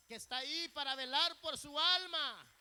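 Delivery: rally the congregation; full-force preaching. Real, not acted. Climax of the sermon. (predicación exaltada a toda voz)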